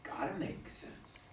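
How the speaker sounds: soft, voiceless murmur to himself; almost to himself. Acted. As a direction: barely breathe it out, inward. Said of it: "Almost to himself".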